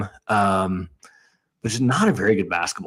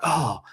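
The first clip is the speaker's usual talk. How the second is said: high soft oh as the shot rolls out; groan of dismay; 6 semitones higher, 4 dB quieter than usual. Retold or imitated. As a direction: let out a deflated oh. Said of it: "I'm like".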